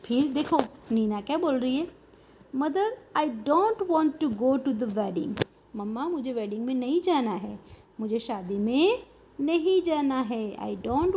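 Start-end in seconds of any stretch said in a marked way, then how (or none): 2.6-5.5 slow reading. Shown as a demonstration. Teacher reads Nina's line slowly.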